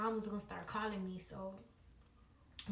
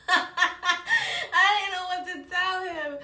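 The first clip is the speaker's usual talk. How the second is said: loud, high burst through laughter; laughing; 8 semitones higher, 12 dB louder, slower than usual. Real, not acted. Storyteller cracking up at her own advice, laughing. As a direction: laugh hard while getting the words out.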